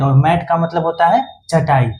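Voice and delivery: ordinary delivery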